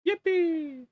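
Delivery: high squeaky yippee cheer; yay. Acted. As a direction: shriek it like a cartoon. Celebrating getting the floor.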